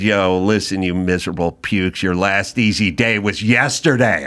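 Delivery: barking drill sergeant. Acted. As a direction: yell like a drill instructor. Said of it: "in my best, like, drill sergeant voice".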